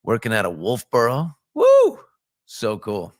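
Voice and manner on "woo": high, loud "woo"; excited whoop. Real, not acted. Host cheering the town he worked out of, excited whoop. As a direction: let out a sudden high-pitched whoop.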